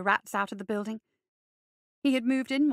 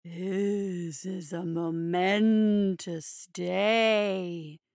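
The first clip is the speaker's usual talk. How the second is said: low, slow, growling spirit voice; 5 semitones lower, much slower than usual. A narrator's reading. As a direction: drawl it like an ancient beast. Voicing December.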